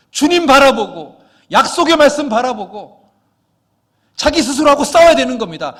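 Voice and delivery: high, loud sermon climax; fiery preaching. Acted. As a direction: preach it at full force to the congregation. Preacher rises to full force.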